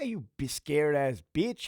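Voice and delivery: normal talking voice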